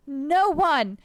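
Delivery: normal talking voice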